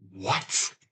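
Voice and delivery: ordinary talk, nothing marked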